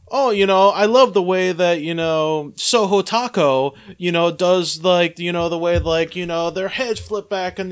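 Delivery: loud, high mock Japanese accent; hammed-up Japanese accent impression. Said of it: "Was that your Japanese accent?".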